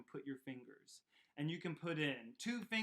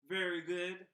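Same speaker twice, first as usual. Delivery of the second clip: ordinary talk, nothing marked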